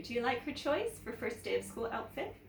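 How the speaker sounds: ordinary delivery, nothing marked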